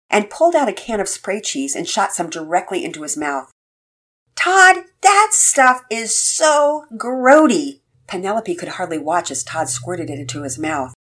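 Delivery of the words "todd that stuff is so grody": disgusted. A narrator's reading. Sister disgusted by spray cheese.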